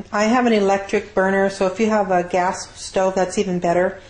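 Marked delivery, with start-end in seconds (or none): none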